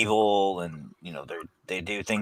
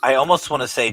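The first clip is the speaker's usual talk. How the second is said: usual speaking voice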